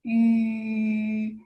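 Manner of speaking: YU held very long and level; sustained rounded vowel. Shown as a demonstration. Teacher showing how U with dots sounds, long YU. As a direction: stretch YU out on rounded lips. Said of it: "it pronounced as yu".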